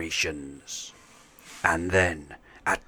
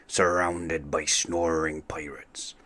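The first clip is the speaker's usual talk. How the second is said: ordinary delivery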